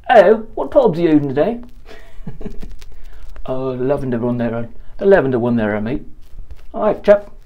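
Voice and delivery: clumsy put-on British accent, chummy. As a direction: ham up a London barber's British accent. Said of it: "I can't do that accent very well".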